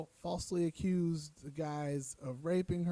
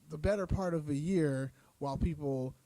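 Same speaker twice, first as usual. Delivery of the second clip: normal talking voice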